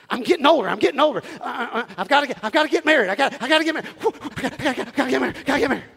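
playful impression of a desperate young person; mock desperate single. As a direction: act out panicked urgency to marry. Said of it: "did y'all hear the desperation there".

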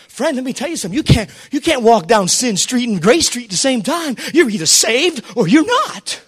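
ordinary delivery

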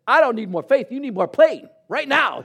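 high, loud punchline retort. Retold or imitated. To the agent urging faith, a loud high retort. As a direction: throw it back at her, loud.

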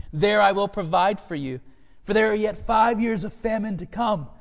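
impassioned scripture reading. A narrator's reading. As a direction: deliver Joseph's message with full feeling. Reads Joseph's message, choking up afterwards.

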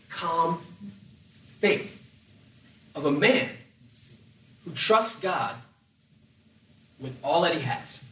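ordinary delivery

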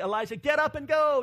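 high, loud rallying cry; urgent command. Acted. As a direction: shout him up onto his feet. God urges Elijah to move.

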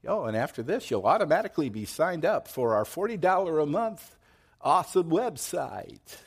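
high, bright-ish mock sales pitch; hammed-up chirpy ad voice; mock salesy fine print. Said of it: "the fine print says".

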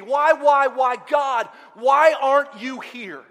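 loud, high, building pleas; anguished cry to God. Acted. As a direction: hurl each question at God in anguish. Acted anguished questioning of God.

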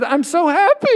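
high, loud, tearful cry of happiness; crying with joy. Retold or imitated. Bride on the train after the wedding, crying happily, high and loud. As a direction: sob it out happily. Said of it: "she burst into tears".

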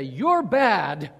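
sudden loud finger-wagging rebuke; scolding accusation. Retold or imitated. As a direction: bark it out like a scolding parent. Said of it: "to wag it at someone and say".

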